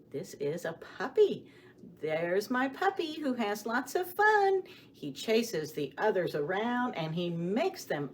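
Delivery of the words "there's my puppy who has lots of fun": usual speaking voice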